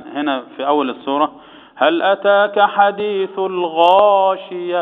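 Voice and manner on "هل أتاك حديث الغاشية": slow chanted verse with a heavy غا; recited demo. Shown as a demonstration. Sheikh demonstrates the verse to the class, slow chant. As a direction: model the verse slowly, leaning on the heavy غا. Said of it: "السؤال هنا الآن".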